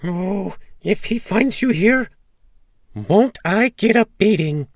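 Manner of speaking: high, loud wail of a frightened wife; terribly scared. A narrator's reading. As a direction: cry out in high-pitched dread. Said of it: "The giant's wife was terribly scared".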